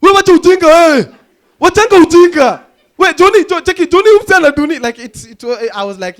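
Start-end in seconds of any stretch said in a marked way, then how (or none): 0.0-4.8 high, loud shouting footballers; angry players yelling in mock Swahili. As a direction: yell it across the pitch, much higher. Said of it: "I could hear the players shouting".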